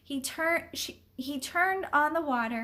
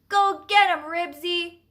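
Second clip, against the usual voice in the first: high, loud cheer; cheering yell; 5 semitones higher, 8 dB louder than usual. A narrator's reading. Boy on bicycle urges the dog on, yelling. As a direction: egg the dog on loudly.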